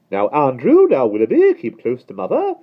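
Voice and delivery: high, loud cooing fuss; fussy lady. A narrator's reading. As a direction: fuss over her dogs. Miss Lark to dogs.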